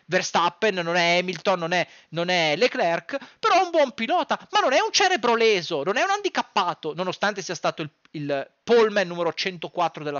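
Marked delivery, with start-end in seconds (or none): none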